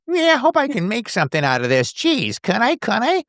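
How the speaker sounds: high, unsure voice full of hope and doubt; breathless hopeful what-if; anxious young writer. Retold or imitated. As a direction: voice a nervous young writer wondering if he can make it. Host voices Robin's hopeful doubts.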